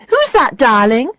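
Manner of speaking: loud voice. Acted. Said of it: "Tanya asks in a loud voice".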